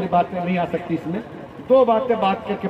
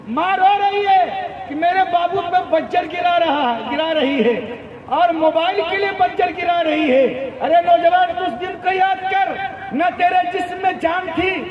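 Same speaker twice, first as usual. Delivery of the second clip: high, relentless sermon cadence; impassioned preaching; 8 semitones higher than usual. Acted. Preacher rebuking young men who forget parents, impassioned. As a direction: drive each phrase home in a raised preaching voice.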